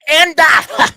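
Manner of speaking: sudden high mocking shout. Streamer mocking a chatter, shouting. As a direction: shout it out in a mock rant.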